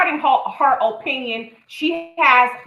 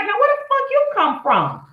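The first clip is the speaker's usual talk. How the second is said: much higher, indignant what-the-hell cry; startled outrage; 11 semitones higher than usual. Retold or imitated. Woman suddenly attacked by another man, shocked high outburst. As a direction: act a woman blindsided by a stranger.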